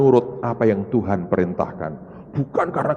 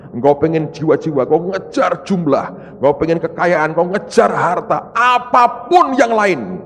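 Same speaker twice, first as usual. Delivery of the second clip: usual speaking voice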